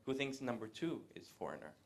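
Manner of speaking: ordinary delivery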